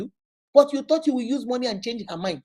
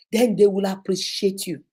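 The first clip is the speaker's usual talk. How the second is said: usual speaking voice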